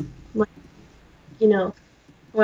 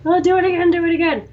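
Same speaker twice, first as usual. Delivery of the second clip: excited urging; 6 semitones higher, 4 dB louder, much faster than usual. Retold or imitated. Friend urging retold.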